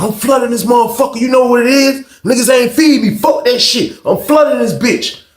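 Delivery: high, defiant angry rant; acted anger. Unfed inmate defending his flood, acted angry rant. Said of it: "but he is angry".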